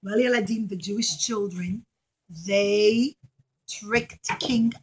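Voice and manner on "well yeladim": plain reading voice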